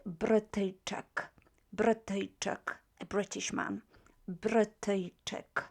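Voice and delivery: Brytyjczyk said slowly and clearly; repeated model word. Shown as a demonstration. Teacher drilling the new word, slow. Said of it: "the word that you see here is Brytyjczyk".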